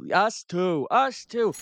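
ordinary talk, nothing marked

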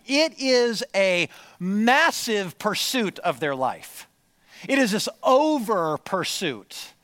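emphatic preacher in full flow; impassioned preaching. Real, not acted. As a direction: drive the point home to the congregation.

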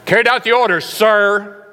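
loud, high barked report; soldier reporting. Retold or imitated. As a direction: snap it off like a private to his sergeant. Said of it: "reporting to your sergeant".

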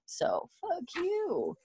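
drawn-out soft high sneer; mock defiant. Bree telling the doomed man off, mock scorn. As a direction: spit it out slow and scornful. Defiant parting shot in retold scene.

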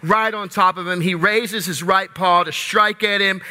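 usual speaking voice

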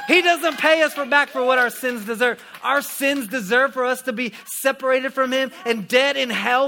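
rousing preaching. Acted. Preaching at full force.

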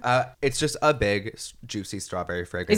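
blocked nose; stuffy, congested voice. Real, not acted. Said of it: "I'm sorry if I sound congested".

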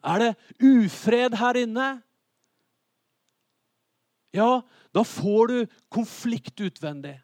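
loud, high challenge and answer; forceful preaching. Real, not acted. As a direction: drive each question home to the congregation. (kraftfull, høylytt forkynnelse)

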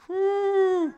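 loud, high-pitched whoop; excited whoop. Real, not acted. Preacher thrilled by his own illustration, high excited whoop. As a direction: let out a long, high "woo".

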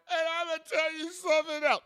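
tipsy diva about to unload; diva meltdown. Retold or imitated. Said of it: "all she needed was a glass of Cabernet in her hand".

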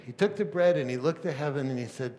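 normal talking voice

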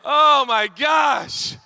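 loud, high, slowly drawn out; exasperated cry. Real, not acted. Parent venting to the congregation, exasperated wailing. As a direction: wail it in comic overwhelm.

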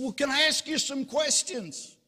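high-pitched acted rescuer; eager questioning. Rescuer offering questions to the jumper, high and eager.

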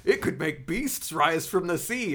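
high, loud, slow doom-monger; conspiracy theorist. Acted. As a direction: proclaim doom like a ranting crank. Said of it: "The conspiracy theorist?".

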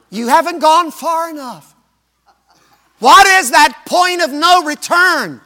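high, loud preaching climax; full-force preaching. Acted. Preacher challenging the congregation, loud and high. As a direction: drive the question home to the congregation.

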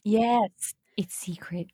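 hushed secretive whisper. Pretend. Conspiratorial secret aside.

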